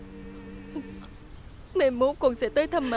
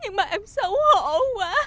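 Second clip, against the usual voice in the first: crying; 5 semitones higher than usual. Acted. Shamed wife crying to her husband, tearful. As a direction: sob out the shame.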